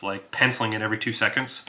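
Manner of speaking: normal talking voice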